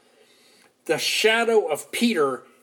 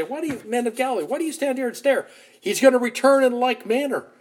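angels' voices; 8 semitones higher, faster than usual. Retold or imitated. Angels address the disciples.

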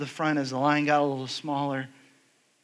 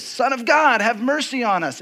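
calling out raised and higher, pleading for mercy; retold shout; 6 semitones higher than usual. Blind beggars calling out to Jesus, retold shout. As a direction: cry out for mercy over a crowd. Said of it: "were shouting out to Jesus".

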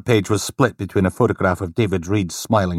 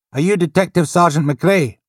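polite desk sergeant, a bit higher; lightly voiced desk sergeant; 11 semitones higher, 6 dB louder than usual. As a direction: greet him with polite formality.

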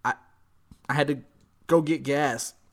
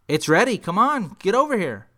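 louder, higher imitation of an impatient sister; urging sister; 5 semitones higher, 4 dB louder than usual. Sister on the phone calling brother to eat, urging loudly. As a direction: hurry him along insistently.

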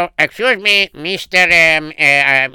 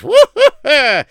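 much higher, slow amazed hoot; impressed hoot; 12 semitones higher, 5 dB louder, slower than usual. Acted. Muttel hoots at the rich man's house, high and slow. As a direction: whoop at the sight of the house.

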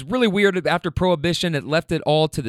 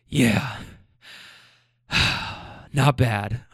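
imitating lower, breathless replies; winded; 4 semitones lower, much slower than usual. Winded husband answering his wife, acted out of breath. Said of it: "now he's really winded".

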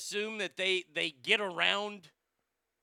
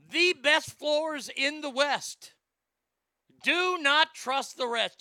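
ad slogan read; 6 semitones higher, 5 dB louder, slower than usual. Acted.

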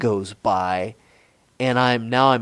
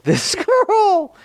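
high squeaky giggle through the words; squeaky laugh; 3 dB louder than usual. Real, not acted. Laughing high while starting a story to a friend. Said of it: "Squeaky laugh makes me happy".

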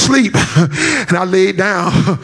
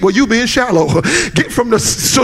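ordinary talk, nothing marked

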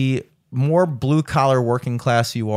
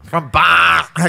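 loud, drawn-out Boston accent, higher; stuffed-nose Boston accent; 4 semitones higher, 6 dB louder, much slower than usual. Shown as a demonstration. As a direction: echo a congested, hammed-up Boston accent. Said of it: "like, a stuffed nose".

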